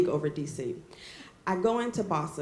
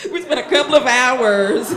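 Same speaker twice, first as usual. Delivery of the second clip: loud, insistent and amused; emphatic self-correction; 4 semitones higher, 11 dB louder than usual. Real, not acted. Storyteller correcting her slip for her mother, loud amused insistence. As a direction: belt out the corrected version.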